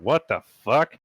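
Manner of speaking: muffled. Acted. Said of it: "a muffled voice coming from Leon's tent".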